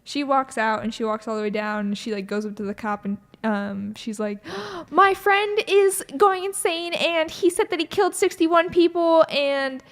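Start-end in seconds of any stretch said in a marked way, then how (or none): 4.7-9.8 high, loud, gasping mock panic; hammed-up hysterical report, drawn out with a gasp; frantic plea. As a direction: act out someone frantically begging for help. Retold panicked plea to a cop.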